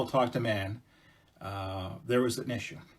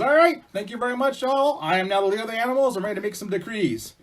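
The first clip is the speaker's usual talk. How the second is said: high, loud victory speech; self-important proclaiming; 10 semitones higher, 7 dB louder than usual. Acted. Beaver thanking everyone after winning, proclaiming grandly. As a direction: announce yourself ruler to the crowd.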